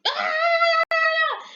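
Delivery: high sharp cry of struggle, far above her voice; retold scream. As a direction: let out a short high fighting scream. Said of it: "she fights".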